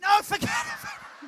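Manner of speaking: very high, slow give-up cry; exasperated squeal. Real, not acted. Host giving up on the rehearsal, exasperated. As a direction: throw your hands up in despair. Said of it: "Just forget I said venom".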